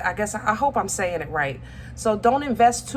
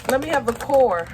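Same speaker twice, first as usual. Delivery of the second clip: ordinary talk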